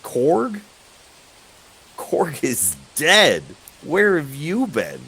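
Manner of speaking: high, loud, scoffing disbelief; loud, high-pitched incredulous questions; incredulous guard. Acted. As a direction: react as if the request is absurd, pitch leaping up. Guard reacts to request for Korg.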